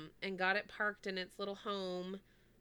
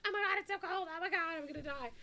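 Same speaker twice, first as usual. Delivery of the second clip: mock screaming; 9 semitones higher than usual. In a cold shower, a high mock shriek. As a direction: squeal it high like freezing water. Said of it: "the whole time I'm screaming".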